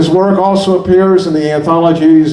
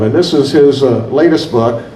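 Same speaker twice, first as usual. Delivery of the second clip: normal talking voice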